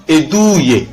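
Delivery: "edouye" with a drawn-out "ou"; long Ou example. Shown as a demonstration. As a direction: stretch the middle vowel of "edouye". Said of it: "Ou, comme".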